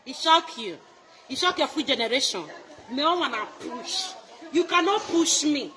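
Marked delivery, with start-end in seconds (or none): none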